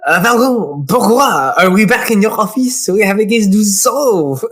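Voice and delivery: put-on fuller, livelier French accent; exaggerated French accent, insistent. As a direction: ham up the French assistant. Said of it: "He's gonna be French".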